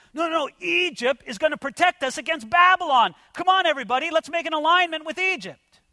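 high-pitched mock politician's pitch; rally everyone like an insistent politician; lightly voiced politician overruling objections; eager politician. Voices politicians urging an Egypt alliance.

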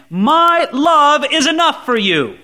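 God speaking, emphatic on LOVE; stress on LOVE. Acted. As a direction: punch out "My love" as a declaration. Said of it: "as if he's saying".

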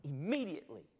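"Immediately" punched hard on the stressed syllable; emphatic. Acted. Preacher quoting Mark on the rescue, emphatic "Immediately". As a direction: hit "Immediately" loudly, then let it fall. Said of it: "it's emphatic".

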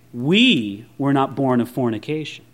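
low, understated sneer. Acted. Jesus' enemies throwing his birth at him, sneering. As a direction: sneer it with a drop in pitch, leaning on WE. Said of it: "Can you see the sneer on their face".

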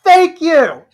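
shouted high thank you, way up and loud; delighted cheer. Real, not acted. Shouting thanks to a friend who just roasted the other, loud and high. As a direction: cheer the friend's jab, cracking up.

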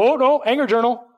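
high, loud cry of oh no; mock alarmed call. Parent calling out the angry child, mock alarmed. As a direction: call it out like a parent catching a sulk.

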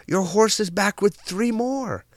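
high-pitched, excited neighbors gushing; frantic delight. Acted. Neighbors hail the farmer's returned horse, frantic delight. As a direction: exclaim it high, as overjoyed neighbors. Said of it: "frantically return back and say".

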